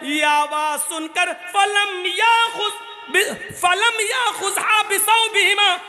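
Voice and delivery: impassioned preaching. Acted. Preacher telling the congregation of children in paradise, fervent preaching. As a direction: preach it with rising fervour to the crowd. Preaching story at full force.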